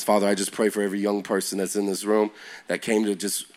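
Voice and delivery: soft, hushed prayer over the listeners. Real, not acted. Preacher praying over the young people, softly. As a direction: pray over them gently.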